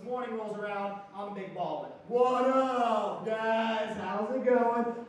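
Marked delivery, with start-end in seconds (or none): 2.1-5.0 loud, stretched-out hello; cocky loud greeting. Acted. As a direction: greet the room like a big shot. Christmas morning, acting like a big baller.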